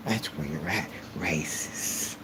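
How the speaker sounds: low breathy whisper of scorn; hissed put-down. Real, not acted. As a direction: breathe the word out in contempt. Hissed dismissal of racists.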